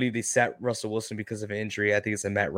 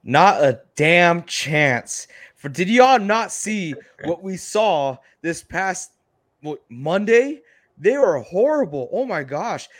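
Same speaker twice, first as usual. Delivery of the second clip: heated outburst; 5 semitones higher, 7 dB louder, slower than usual. Real, not acted. Heated disagreement over a pick.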